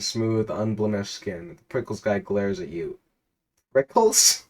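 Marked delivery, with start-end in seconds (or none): 3.7-4.4 incredulous squeak. Real, not acted. Mocking mispronounced name.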